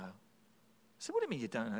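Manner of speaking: ordinary talk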